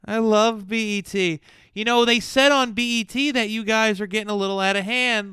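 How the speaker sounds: loud, high chatty voice; hammed-up clueless gossip. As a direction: gush like a clueless tourist.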